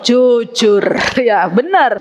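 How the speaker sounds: ordinary talk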